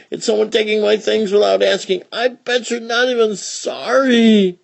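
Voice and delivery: put-on high, loud, furious child's rant; angry outburst. Child yells at the one who took her crayons, furious. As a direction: tear into the crayon thief. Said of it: "An outburst in many words".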